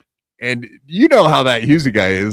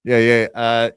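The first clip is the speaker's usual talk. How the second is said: put-on Irish accent; 3 semitones higher than usual. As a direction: attempt an Irish accent on request. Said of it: "now you gotta do it".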